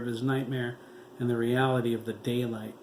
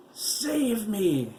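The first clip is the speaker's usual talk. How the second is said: much higher, loud desperate scream; 10 semitones higher, 5 dB louder than usual. A narrator's reading. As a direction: shriek it for help. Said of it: "he screamed".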